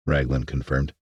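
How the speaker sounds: usual speaking voice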